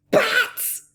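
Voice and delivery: very high, loud cry; cried out. A narrator's reading. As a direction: shriek the word. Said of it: "they cried".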